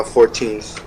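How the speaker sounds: normal talking voice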